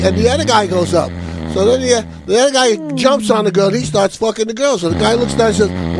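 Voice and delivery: ordinary talk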